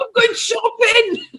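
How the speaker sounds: high, slow, laughing shout; mock excitement. [name] joking about the thrill of shopping, laughing shout. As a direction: cheer it as the best outing ever.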